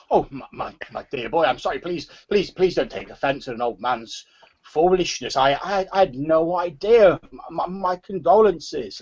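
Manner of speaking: put-on high, stammering old-man apology; dismayed old man. Old man offering condolences after his blunder, dismayed and stammering. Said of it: "old man's face sort of falls".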